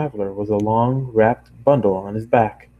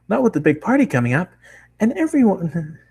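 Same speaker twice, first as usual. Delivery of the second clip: higher, fast bubbly chatter; fast pony girl; 8 semitones higher than usual. Acted. Bakery girl gushing about the party, high and fast. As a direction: race through it gleefully. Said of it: "Can't speak that fast".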